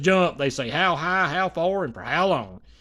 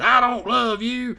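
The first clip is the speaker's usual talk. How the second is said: hammed-up angry old mother; imitating mama's scolding; 8 semitones higher, 5 dB louder than usual. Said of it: "she was talking kinda like that".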